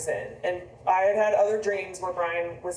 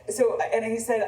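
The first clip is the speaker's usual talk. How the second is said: ordinary talk, nothing marked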